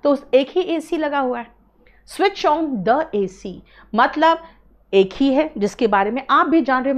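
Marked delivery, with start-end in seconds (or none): 2.1-3.5 slow example reading. Shown as a demonstration. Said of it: "example repeated".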